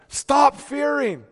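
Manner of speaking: slow, loud, high commanding shout. Acted. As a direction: order them to stop fearing. Preacher commanding congregation.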